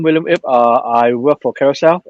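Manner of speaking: usual speaking voice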